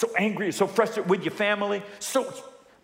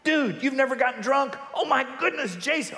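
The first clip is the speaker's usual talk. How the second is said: higher, taunting peer voice; pushy friend; 8 semitones higher than usual. Retold or imitated. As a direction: egg him on like a pushy buddy. Pastor voicing pressuring friends.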